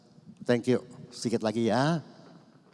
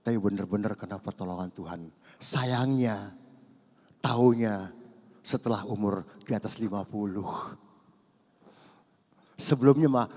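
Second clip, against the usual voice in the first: usual speaking voice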